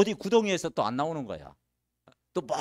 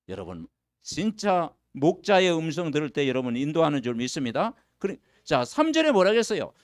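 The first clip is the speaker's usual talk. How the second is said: ordinary talk, nothing marked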